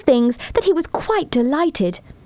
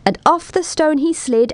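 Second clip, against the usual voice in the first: ordinary delivery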